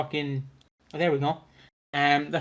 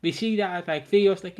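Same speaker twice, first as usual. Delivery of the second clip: ordinary talk, nothing marked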